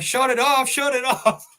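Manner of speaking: high, loud urgent holler. Retold or imitated. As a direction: re-enact yelling for someone to cut the power. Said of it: "I'm hollering to him".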